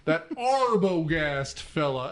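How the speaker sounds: "Arbogast" drawn out slow and loud; sheriff's pronunciation. Retold or imitated. Host imitating how the sheriff says it, "Arbogast" exaggerated. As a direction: lean hard and long on "Arbogast", mimicking the sheriff. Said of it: "the way the sheriff says Arbogast".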